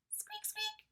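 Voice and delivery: very high squeaky mouse noise; mouse squeak. A narrator's reading. Narrator voices Peanut the mouse squeaking. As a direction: squeak like a tiny frightened mouse.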